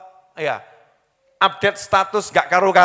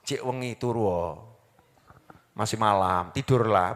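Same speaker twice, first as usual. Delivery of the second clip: devil's voice; 10 semitones lower, 7 dB quieter than usual. Retold or imitated. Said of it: "kata setannya".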